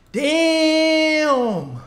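stunned. Real, not acted. Reacts to two hundred dollar win.

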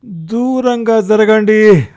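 high, loud, drawn-out yell; exasperated cry, stretched out; impatient shout. A narrator's reading. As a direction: scream at them to back off. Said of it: "అసహనంగా అరిచాను".

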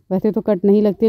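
normal talking voice

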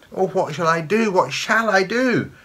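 put-on frightened girl's anguished cry; terrified. A narrator's reading. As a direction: fret desperately in a high voice. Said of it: "thought the poor terrified granddaughter".

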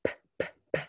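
"P, p, p" as short unvoiced puffs; isolated p sound. Shown as a demonstration. Teacher modelling the last sound of "tap", unvoiced p. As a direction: pop out the final p sound. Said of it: "find those last sounds".

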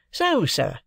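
a lightly voiced city clerk; Wemmick voiced. A narrator's reading. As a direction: play the dry, proud clerk.

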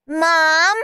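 high, loud wail. A narrator's reading. Steve telling on Henry, wailing. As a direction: wail for your mother. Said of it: "wailed Steve".

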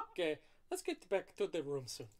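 a light hint of a Mario accent; lightly voiced cartoon Italian. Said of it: "I'm doing a Mario Italian voice for Vasilico".

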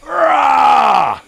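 big roaring shout; lion roar. Shown as a demonstration. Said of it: "give me a roar".

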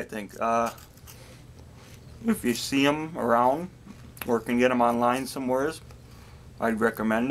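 talking with food in mouth; mumbling between bites; chewing while talking. Real, not acted. Seen 0: Left speaker ate a snack at -2; jaw keeps working while talking.

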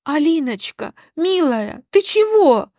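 high-pitched, pleading flustered aunt; fussing, flustered aunt. A narrator's reading. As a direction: fuss anxiously, trying to placate. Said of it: "засуетилась Тамара Александровна".

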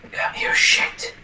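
slow tense whisper. Real, not acted. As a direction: breathe it under your voice. Sneaking in game.

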